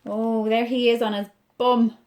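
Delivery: ordinary delivery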